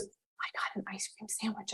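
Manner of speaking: whispered like a confession; secret whisper. Retold or imitated.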